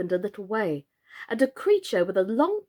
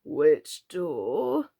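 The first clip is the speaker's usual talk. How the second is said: lower, slow frog voice; old frog; 5 semitones lower than usual. A narrator's reading. Old frog answers Alice, low and slow. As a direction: croak it out, unbothered.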